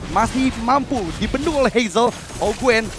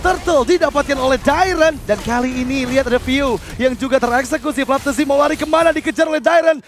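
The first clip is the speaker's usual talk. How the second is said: fast, loud hype play-by-play; esports caster; 6 semitones higher, 4 dB louder than usual. Real, not acted. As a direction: call the teamfight at full tilt. Hype play-by-play during fight. (caster esports yang heboh)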